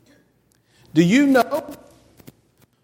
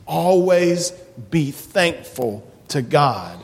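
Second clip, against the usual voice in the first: ordinary talk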